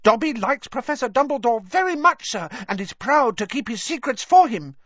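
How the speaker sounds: high, loud squeaky elf voice; proud Dobby elf. Acted. Dobby praises Dumbledore to Harry, high and proud. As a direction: declare loyalty as a proud little elf.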